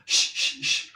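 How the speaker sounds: short repeated "shh" hisses; voiceless shush, as if silencing someone; shushing sound. Shown as a demonstration. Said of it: "como si mandaras callar a alguien". (chistido, como mandando callar)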